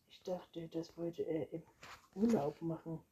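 ordinary delivery, nothing marked

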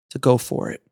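ordinary talk

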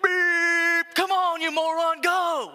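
loud, high, furious shout at the driver; mock road-rage yell at a slow driver; angry honk yell. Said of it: "What you really wanna do is go".

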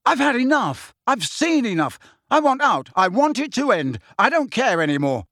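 high, anguished outburst, easing at the end; furious yelling. A narrator's reading. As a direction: cry out in a string of bursts. Harry yelling while smashing things.

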